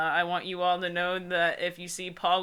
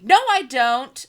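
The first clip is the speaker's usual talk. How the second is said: all caps; 5 semitones higher, 7 dB louder than usual. Shown as a demonstration. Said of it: "Again, all caps".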